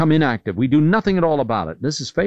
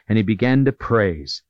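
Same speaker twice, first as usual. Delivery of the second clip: usual speaking voice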